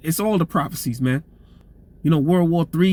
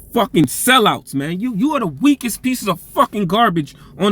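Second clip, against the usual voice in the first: riled-up tirade; 3 semitones higher than usual. Real, not acted. Preacher condemning the sellouts, real riled-up anger. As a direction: spit the insults out in hot anger. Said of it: "Sometimes you get riled up".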